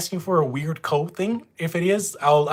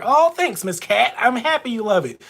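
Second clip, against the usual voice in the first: delighted thanks; 9 semitones higher than usual. Real, not acted. Thanking a new viewer.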